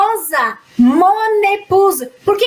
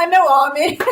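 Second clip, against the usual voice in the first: breaking into a laugh on the phrase; laughing through a joke quote; amused mock quote; 2 semitones lower than usual. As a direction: try the odd phrase out and crack up.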